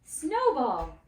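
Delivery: loud call across the farm. Acted. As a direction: shout the sheep's name. Calling the sheep's name loudly.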